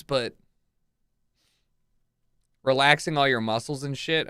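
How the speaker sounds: ordinary talk, nothing marked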